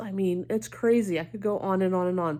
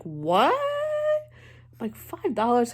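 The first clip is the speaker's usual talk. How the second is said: surprised; 7 semitones higher, much slower than usual. Retold or imitated. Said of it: "and I was like".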